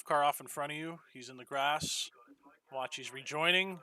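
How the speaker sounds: loud, urgent spotter call; urgent warning. Real, not acted. Spotter warns of a car off.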